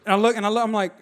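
normal talking voice

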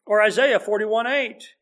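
ordinary talk

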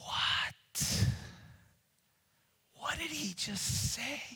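breathless then very high disbelief; shocked crowd. Retold or imitated. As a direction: gasp out the shock. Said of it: "would have gone".

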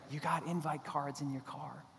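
soft, distant inner voice; a quiet nudge from somewhere else; God prompting him to invite them; God's voice. Retold or imitated. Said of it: "he's like".

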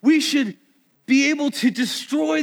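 high, loud preaching call; rallying shout. Real, not acted. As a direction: fire up the congregation.

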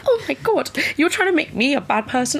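high, laughing, hammed-up indignation; hammed-up pearl-clutching outrage, high and half-laughing; mock outraged self-defence. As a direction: play the offended innocent, pitched high and laughing. Mocking offended people who were called out.

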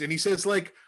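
ordinary talk, nothing marked

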